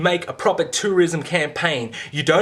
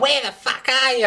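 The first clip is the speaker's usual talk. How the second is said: higher mock brash ad girl; mocking Lara Bingle; 7 semitones higher than usual. Mocking the tourism ad girl with a higher brash voice. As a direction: parody the swearing ad star, pitched up. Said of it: "Lara Bingle going".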